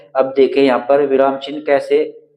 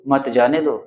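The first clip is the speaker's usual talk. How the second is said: usual speaking voice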